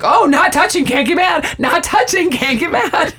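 high, teasing sing-song taunt; childish taunting. Retold or imitated. Chandler holds a hand by Joey's face, childish taunting. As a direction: needle him like an annoying sibling.

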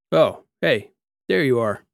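a hint of a cheerful cyborg; friendly cyborg. A narrator's reading. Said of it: "he said, getting up".

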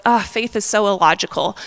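normal talking voice

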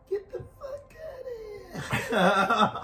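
put-on S0 failing to do the Brooklyn accent as well as Kane does it; failed Brooklyn accent. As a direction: try the Brooklyn line, stretch it out and lose it laughing. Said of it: "I can't do it".

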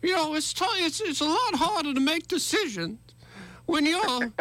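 put-on voice of a Southern governor; Kay Ivey. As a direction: imitate the governor's accent, playfully exaggerated. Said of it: "I loved the K I V, the governor's, uh, quick little".

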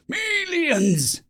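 high, stretched-out cry of alarm; high-pitched alarmed yell on one long word; drawn-out yell. A narrator's reading. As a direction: yell it as the agitated soldier. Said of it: "in the loudest voice he could muster, he yelled".

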